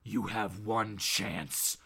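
very low, slow, quiet menace; deep voice, drawn-out threat; Batman. Acted. The vigilante giving Bob one chance, low and menacing. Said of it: "And Batman goes".

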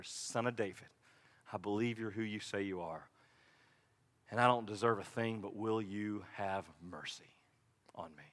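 low, very soft plea; hushed prayer. Acted. Demonstrates a short prayer.

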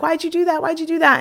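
high-pitched, insistent mock questioning; upset friend imitation. Retelling her friend's upset questioning.

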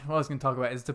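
normal talking voice

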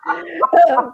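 laughing through a cheery promise; laughing reassurance. Retold or imitated. Laughing as she reassures the other mom she's cool. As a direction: giggle while chirping it'll be fun. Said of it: "look at the mom and be like".

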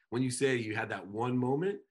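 usual speaking voice

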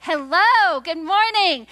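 loud high greeting to the room; calling out. Real, not acted. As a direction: call out to wake the crowd. Preacher calling out to congregation.

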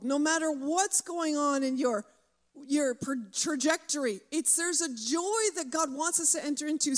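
ordinary talk

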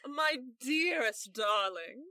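slow, mock-gushing endearment; sarcastic. Lavender greeting her friend Ben, sarcastic gushing. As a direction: lay the endearment on thick and slow. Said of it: "she says sarcastically".

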